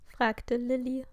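ordinary delivery

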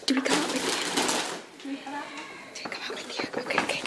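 hushed urgent whisper. Real, not acted. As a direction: whisper it so nobody hears. Hiding, waiting to surprise.

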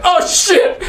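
high, startled yelp of fear; a sudden jolt of terror, voice cracking up high; frightened squeak. Retold or imitated. Seeing the demon rise toward her, a frightened high yelp.

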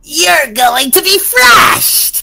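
loud, slow villain threat with cackle; villain laugh. Pretend. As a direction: shout it out like a cartoon villain.

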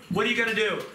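usual speaking voice

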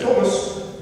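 high-pitched British mother-in-law; British accent. Retold or imitated. Mother-in-law addressing the preacher, imitated British accent. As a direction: call the name in a high English accent. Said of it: "with her British accent".

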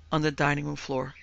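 plain reading voice

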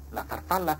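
plain reading voice

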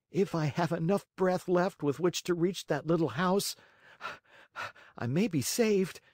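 put-on a marionette boy gasping hope to himself; Pinocchio voice.

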